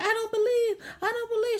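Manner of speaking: high, crying plea of disbelief; panicked denial. Retold or imitated. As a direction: beg in terror, voice up high. Victim panics in ritual.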